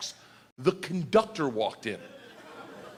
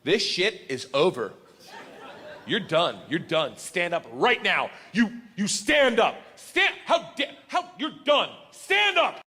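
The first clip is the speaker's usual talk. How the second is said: furious teacher; 3 semitones higher, 3 dB louder, slower than usual. Retold or imitated. Chaperone bursting into the cabin, a mock furious rising scold.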